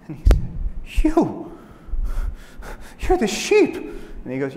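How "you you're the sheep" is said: high, loud gasped-out realization; stunned recognition. Acted. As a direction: cry it out as the shocked wolf. Wolf recognizes the eaten sheep.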